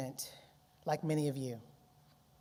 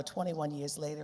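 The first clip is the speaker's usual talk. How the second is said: ordinary talk, nothing marked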